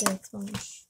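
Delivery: ordinary delivery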